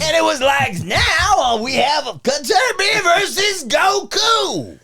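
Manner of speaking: big shouted fight-announcer voice, slow and gruff; gruff cartoon ring announcer at full force. Shown as a demonstration. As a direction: announce the match in a gruff, drawn-out roar. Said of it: "Gruff like how?".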